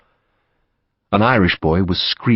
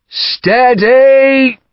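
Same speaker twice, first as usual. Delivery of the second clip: high, loud, drawn-out holding call; shouted restraint; 7 dB louder than usual. A narrator's reading. Commander to men eager to charge, shouting. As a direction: shout to hold the men back. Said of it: "Gough shouted".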